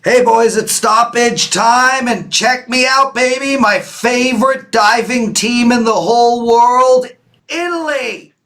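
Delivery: high, loud sports announcer hype; over-the-top high, loud announcer voice; hyped announcer. Pretend. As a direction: boom it out like a stadium announcer introducing the show. Hosts fake announcer intro.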